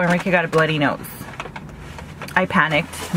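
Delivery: ordinary delivery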